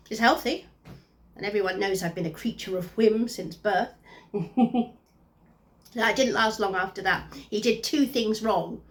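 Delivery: ordinary delivery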